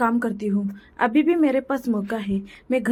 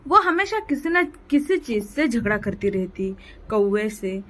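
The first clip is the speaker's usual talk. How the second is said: ordinary delivery, nothing marked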